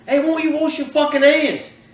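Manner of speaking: high, slow mock British scold; imitating a Brit telling him off, in a British accent. Retelling the Brit at the bar urinal, in a high mock British accent. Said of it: "I hear this Brit".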